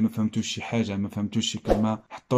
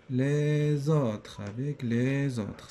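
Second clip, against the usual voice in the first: very slow, drawn-out syllables; slow dictation; much slower than usual. Shown as a demonstration. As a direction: stretch the words out as if writing them down. Teacher dictating words while writing.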